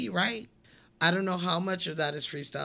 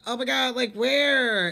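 excited Spike Lee; 7 semitones higher, 7 dB louder than usual. Retold or imitated. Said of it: "And he's like".

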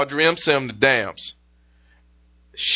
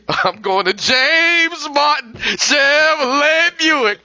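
gleeful; 8 semitones higher, much slower than usual. Pretend. Imagined excited doctor.

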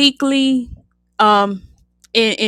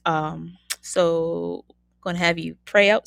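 normal talking voice

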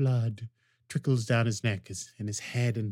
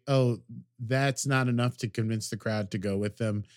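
usual speaking voice

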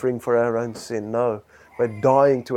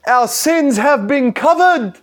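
preaching cry; 13 semitones higher, 7 dB louder than usual. Acted. Preaching peak.

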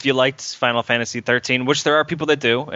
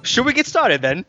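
put-on British accent; 12 semitones higher than usual. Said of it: "you were fucking doing a British accent".

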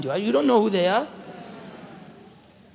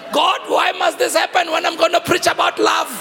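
anguished cry to God; 8 semitones higher, 4 dB louder than usual. Retold or imitated. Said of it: "I'm saying".

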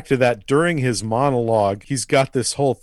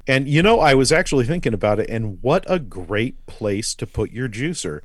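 ordinary delivery

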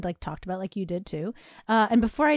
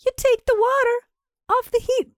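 high, louder recipe reveal; mock-dramatic instruction; 12 semitones higher, 4 dB louder than usual. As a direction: build up each step as a big reveal. Acts out fussy recipe steps.

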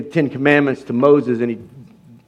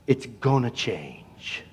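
much softer, slow resolve; hushed vow; 6 semitones lower, 14 dB quieter, slower than usual. Real, not acted. Preacher defying the devil, hushed and slow. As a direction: quietly promise it will change.

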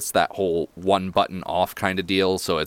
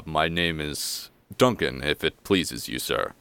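humble butler; 3 semitones lower, 3 dB quieter than usual. Acted. Butler introducing himself to the Goliath, low and humble. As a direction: introduce yourself deferentially, sinking lower at the end. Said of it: "he turns to you and".

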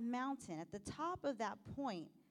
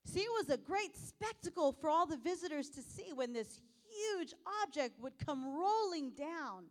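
ordinary talk, nothing marked

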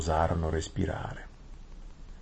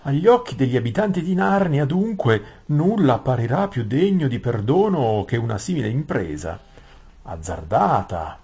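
higher, singsong courtly vizier; Gran Visir ossequioso; 5 semitones higher, 5 dB louder than usual. Acted. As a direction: plead the case in an ornate, higher voice. (gran visir cerimonioso, voce più acuta)

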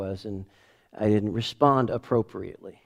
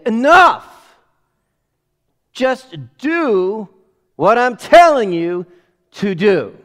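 loud, high exasperated shout; parent yelling; 8 semitones higher, 10 dB louder, slower than usual. Retold or imitated. Father snapping at his arguing kids, loud exasperated shout. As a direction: yell it at the kids like a fed-up parent.

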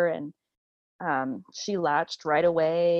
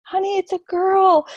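excited; 12 semitones higher, 7 dB louder than usual. Retold or imitated. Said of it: "and he said".

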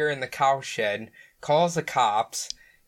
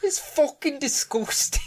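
high, disgusted Scottish mum voice; 14 semitones higher than usual. Retold or imitated. Echoing the mum from the video, high disgusted Scottish. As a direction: spit it out like the outraged mum.

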